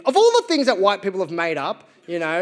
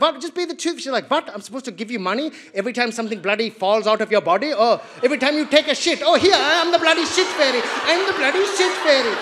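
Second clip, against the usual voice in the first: high, loud mock-outraged mum voice; indignant mum; 5 semitones higher, 3 dB louder than usual. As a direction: rant like an exasperated migrant mum. Mum ranting about tooth fairy.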